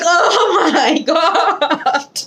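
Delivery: high squeal through laughter; laughing disbelief. Retold or imitated. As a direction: burst out laughing in amazement. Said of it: "They were like".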